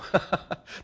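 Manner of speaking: breathy amused chuckle; a quick laugh at the answer; soft laugh. Real, not acted. Pastor amused by the blunt answer, light laugh.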